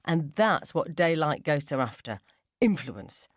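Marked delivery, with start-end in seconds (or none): none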